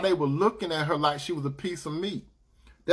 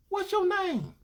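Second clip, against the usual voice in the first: high woman's voice; 4 dB quieter, slower than usual. Retold or imitated. Retelling woman asking his name.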